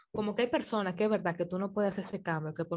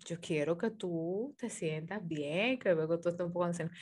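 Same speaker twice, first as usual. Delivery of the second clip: gentle suitor; 3 semitones lower, 7 dB quieter than usual. Retold or imitated. Suitor voiced softly in story.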